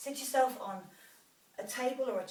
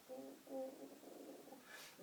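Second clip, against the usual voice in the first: straining oohs; 5 semitones higher, 15 dB quieter, much slower than usual. Real, not acted. Straining in a deep stretch.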